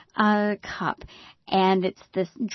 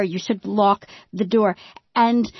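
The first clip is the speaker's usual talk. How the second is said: usual speaking voice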